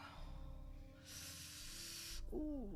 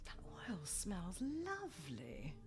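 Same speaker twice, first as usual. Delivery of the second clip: slow, soft, whispered murmur; hushed, relaxed appreciation; blissful whisper; 3 semitones lower, 9 dB quieter, slower than usual. Acted. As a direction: savour it drowsily, almost without voice. Relaxed client during massage.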